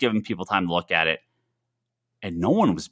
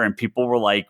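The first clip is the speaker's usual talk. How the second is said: ordinary talk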